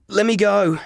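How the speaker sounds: high, loud desperate cry; desperate plea. Acted. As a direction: beg her to let go. Pleading with the ice maiden.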